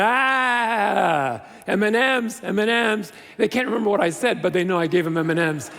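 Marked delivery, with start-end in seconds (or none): 0.0-3.1 long drawn-out "ah" of recognition; mock delighted cry, stretched out; imitating excited students. Former students spotting the speaker years later, imitated excited recognition. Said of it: "many students later in their years going".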